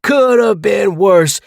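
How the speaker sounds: shouted, slow, high mock of James's catchphrase. [name] yelling James's catchphrase back at him, mocking. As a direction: throw his words back at him in a yell.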